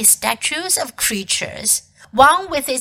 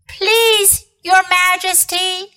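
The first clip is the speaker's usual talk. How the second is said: high-pitched pleading fox; timid, high fox voice; deferential fox; 8 semitones higher, slower than usual. A narrator's reading. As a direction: plead humbly with a queen, voice raised high.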